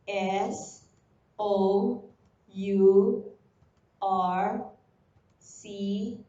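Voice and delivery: letter by letter, each on its own; spelling aloud. Shown as a demonstration. Teacher spelling the word for the class, letter by letter. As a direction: spell out "R E S O U R C E F U L" with pauses. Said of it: "Now let us spell the word resourceful".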